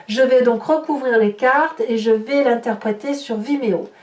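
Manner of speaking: normal talking voice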